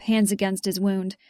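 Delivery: plain reading voice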